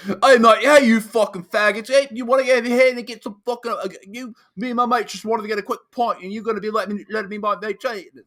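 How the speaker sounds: high, loud, rapid-fire mock Cockney; fast Cockney accent. Imitating British guys cursing in Amsterdam, fast Cockney accent. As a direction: gabble it in a thick Cockney accent. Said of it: "just speaking so quickly".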